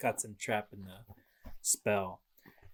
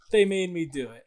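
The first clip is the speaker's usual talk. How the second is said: higher, louder whiny excuse; mock-innocent Odin; 7 semitones higher, 9 dB louder than usual. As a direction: whine it like a caught child. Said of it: "he's like".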